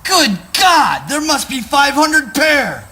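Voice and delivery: high, loud exclamation of horror; shocked outcry. Acted. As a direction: cry out, aghast at the discovery. Shocked at the heap of shoes.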